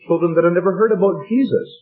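ordinary delivery

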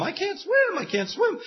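high panicked cry of a man who can't swim. Retold or imitated. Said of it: "you're crying out the whole time".

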